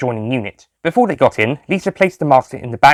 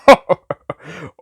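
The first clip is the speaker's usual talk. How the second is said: high, loud hearty laugh; 7 semitones higher, 5 dB louder than usual. A narrator's reading. Doctor laughing at Lisa's joke in the car, hearty. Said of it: "The Doctor started to laugh".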